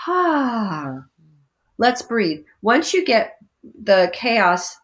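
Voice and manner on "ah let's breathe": soothing. Pretend. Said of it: "And you go".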